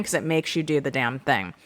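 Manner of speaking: ordinary talk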